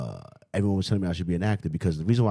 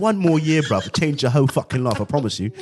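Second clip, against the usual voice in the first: put-on louder, higher pleading London accent; British self; 6 semitones higher, 4 dB louder than usual. British self begging to take over, pleading London accent. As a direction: beg excitedly as a Londoner. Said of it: "sometimes my British self talks".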